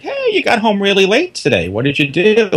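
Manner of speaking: high questioning mother; lightly voiced mom. Voicing mother in the story.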